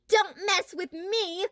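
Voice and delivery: high, slow mock threat; mock yell. A narrator's reading. Boy waving bread at the bully, hammed-up yell. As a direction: ham up a tough-guy yell. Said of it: "Lewis yelled".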